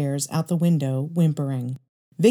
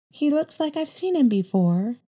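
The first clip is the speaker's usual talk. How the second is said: put-on much higher child's voice, curious; little girl's voice; 10 semitones higher than usual. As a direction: ask like a curious eight-year-old. Young girl to mother.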